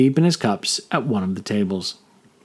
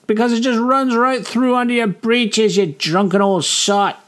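a higher, lighter voice for the tavern barmaid; sharp-tongued barmaid scolding a drunk; 12 semitones higher than usual. A narrator's reading. Barmaid Rella retorts.

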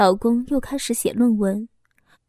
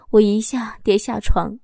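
plain reading voice

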